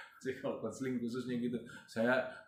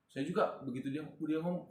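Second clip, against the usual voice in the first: normal talking voice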